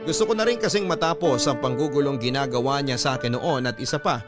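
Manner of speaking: usual speaking voice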